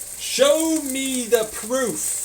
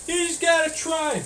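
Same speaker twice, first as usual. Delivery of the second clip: higher, sing-song advice giver; mock platitude voice; 6 semitones higher, 4 dB quieter than usual. Mocking the platitudes people say, higher. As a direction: parrot the cheap advice lightly. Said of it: "they say".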